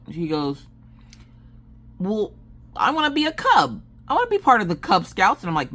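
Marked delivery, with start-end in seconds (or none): 2.0-5.4 put-on much higher, pleading kid voice; little girl's voice. Mother imitating her daughter begging the scout leader, high pleading voice. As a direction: whine it like a small girl who won't give up.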